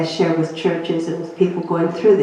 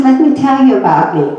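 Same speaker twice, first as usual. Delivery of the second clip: higher and louder, pushing straight into his own story; eager self-introduction; 7 semitones higher, 6 dB louder than usual. Retold or imitated. Doctor cutting past the patient's news, eager and loud. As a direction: barrel on, not hearing a word. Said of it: "He never heard, never asked me a question".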